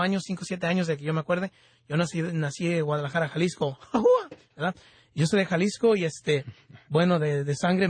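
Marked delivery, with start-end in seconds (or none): 3.8-4.3 high, whooping "ajúa" cheer; Mexican grito. Real, not acted. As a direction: let out a short, proud high whoop. Proud shout after naming Jalisco.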